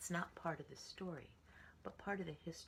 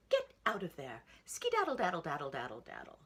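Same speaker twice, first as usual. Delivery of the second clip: loud, higher scolding call; mother shooing; 3 semitones higher, 10 dB louder than usual. A narrator's reading. Mommy yelling at the piggy in the puddle, loud scold. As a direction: shout the piggy out of the mud. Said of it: "See her mommy".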